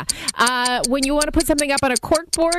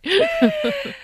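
high-pitched giggle; high laugh. Real, not acted. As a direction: squeal with laughter. Host laughs at joke.

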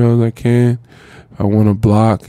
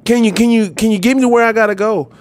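frantic pleading; 11 semitones higher than usual. Retold or imitated. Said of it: "I'm like".

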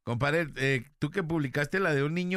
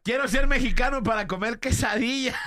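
laughing mock child; 6 semitones higher, 5 dB louder than usual. Retelling a child's wish.

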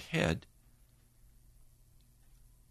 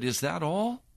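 surprised plea; 9 semitones higher than usual. Retold or imitated. God leaving, he calls after.

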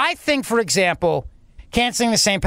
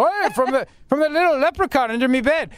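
higher-pitched, hammed-up Irish brogue; mock Irish accent; 4 semitones higher than usual. Host parodying the governor's claimed authority, mock Irish accent. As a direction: lay on a mocking Irish leprechaun accent.

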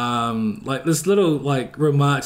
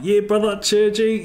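higher-pitched Kiwi character voice; Pope; 7 semitones higher than usual. Acted. Demonstrating his own character in America, lively Kiwi voice. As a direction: lift it into a full Kiwi roleplay voice.

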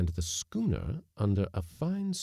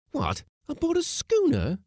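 high, louder, astonished question; incredulous, pitch leaping up; strong amazement; 6 dB louder than usual. A narrator's reading. Mate questioning a lady cook on a schooner, amazed. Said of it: "in tones of strong amazement".

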